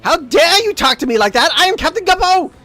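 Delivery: high, loud mock outrage; hammed-up indignant little captain; pompous, offended officer shouting; Captain Guppo. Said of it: "And he's like".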